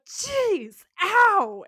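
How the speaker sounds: high, loud pained yelp; outraged yelp. Retold or imitated. Sam hit in the face with a plunger, outraged yelp. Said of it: "Sam is like".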